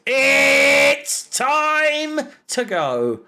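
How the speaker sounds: very slow, high drawn-out call; Claude. Retold or imitated. Fan imitating an iconic rant, stretched-out call. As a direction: hold the first word long, then let it fall away. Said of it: "As Claude once very iconically said".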